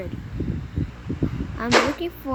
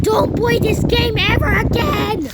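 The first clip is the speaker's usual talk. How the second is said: angry outburst; 8 semitones higher than usual. Real, not acted. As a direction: yell the warning at viewers. Gamer ends video raging.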